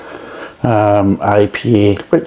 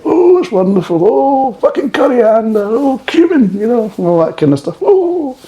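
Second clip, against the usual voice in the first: gushing falsetto, much higher; mocking Jamie Oliver; 13 semitones higher than usual. Mocking Jamie Oliver swooning over craft beer, high falsetto. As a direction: coo over the spices like a swooning TV chef. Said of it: "Jamie Oliver getting guzzled on the old craft beer".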